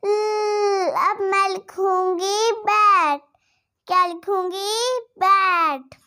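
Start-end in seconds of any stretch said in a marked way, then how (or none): 0.0-0.9 ल held as a long "lll"; tongue-up L sound. Shown as a demonstration. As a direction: lift the tongue and sustain ल. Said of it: "क्या कहेंगे?". (जीभ ऊपर, लंबा ल)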